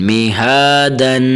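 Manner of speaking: میہادن very slowly, the ہا held one alif long. Shown as a demonstration. Teacher shows students the stretched standing fatha in میہادن. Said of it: "ایک الف کے برابر کھینچنا ہے".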